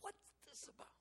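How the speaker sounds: hushed, whispered question to God; acted-out whispered prayer; sad whisper. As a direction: whisper it in sorrowful bewilderment. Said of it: "I was so sad".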